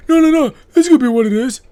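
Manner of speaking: a hammed-up fast protest; excited insistence. Quoting a character insisting.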